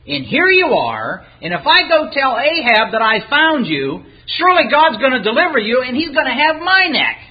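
high-pitched, worried objection; alarmed protest. Acted. Man fears for his neck once he tells Ahab, alarmed protest.

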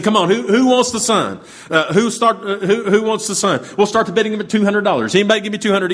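auctioneer calling out. Retold or imitated.

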